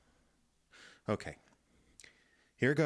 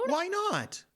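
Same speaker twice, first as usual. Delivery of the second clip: baffled; 4 dB louder than usual. Acted. Baffled by the alarm.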